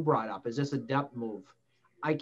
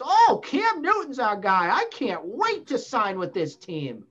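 mock excited player; 6 semitones higher, 3 dB louder than usual. Mock quote of free agents.

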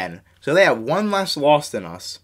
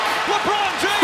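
high excited laugh; 6 dB quieter than usual. Real, not acted. Laugh after block.

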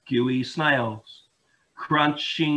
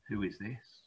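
plain reading voice

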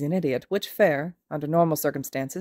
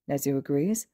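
ordinary delivery, nothing marked

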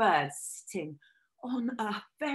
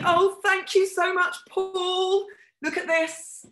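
mock delight; 8 semitones higher, 4 dB louder, slower than usual. Host handed a rehearsal serpent.

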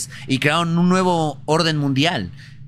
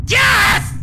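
excited yell; 5 dB louder than usual. Real, not acted. Celebrating loot.

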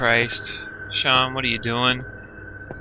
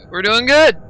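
adrenaline shakes; 9 semitones higher, 7 dB louder than usual. Real, not acted. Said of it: "my heart is pounding".

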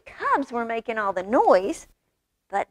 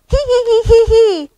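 loud, very high titter; giggling doll; 12 semitones higher, 12 dB louder than usual. A narrator's reading. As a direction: squeal out a toy's giggle. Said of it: "goes the doll".

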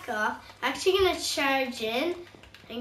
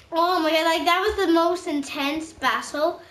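normal talking voice

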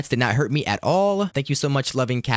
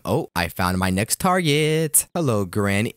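ordinary talk

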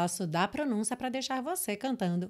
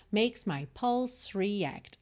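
slow demo reading; much slower than usual. Shown as a demonstration. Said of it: "o E de pulse não é pronunciado".

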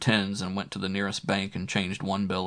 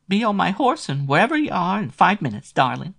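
much higher, breathless sultry woman's voice; eager lover; 12 semitones higher, 7 dB louder than usual. A narrator's reading. Flo promising Matt she'll drive right over, higher sultry voice. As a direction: promise to come running at once.

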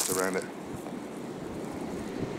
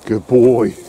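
loud praise to dog; 7 dB louder than usual. Real, not acted.

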